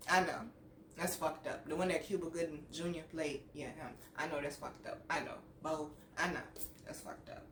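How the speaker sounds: low, very soft admission; quietly owning up to it; hushed guilty aside. Real, not acted. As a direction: drop to a sheepish murmur, almost under the breath. Guilty aside about the nickname.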